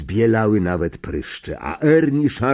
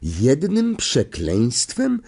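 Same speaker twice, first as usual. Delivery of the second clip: higher, slow, rising question; impressed conductor; 5 semitones higher, much slower than usual. Acted. Stan marvels to Harry at the crime, impressed conductor. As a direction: echo it in amazed relish, drawn out.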